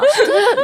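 sudden high laugh; high burst of laughter. Real, not acted.

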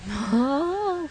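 short high giggle, falling away; sheepish little laugh; embarrassed laugh. Real, not acted. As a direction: laugh it off, a bit flustered. Embarrassed laugh while confessing.

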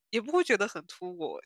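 ordinary talk, nothing marked

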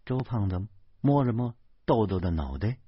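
ordinary delivery, nothing marked

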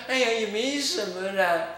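a hint of a flustered nun brushing it off; mock-casual nun, waving it away; lightly voiced nun. Nun covering her tracks on the phone, lightly mimicked and evasive.

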